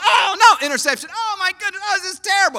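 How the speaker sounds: high yelps of a fretting fan; mock fan panic. Preacher imitating a panicking football fan, high and fretful. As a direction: play a sports fan losing it. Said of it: "they're freaking out".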